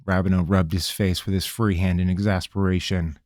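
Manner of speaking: ordinary delivery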